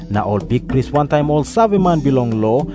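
normal talking voice

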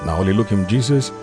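ordinary delivery

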